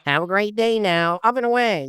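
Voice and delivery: a silly squeaky gnome sign-off; bad gnome voice. Shown as a demonstration. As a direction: sing it up high like a cheerful little gnome. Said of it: "they, like, talk in a bad voice".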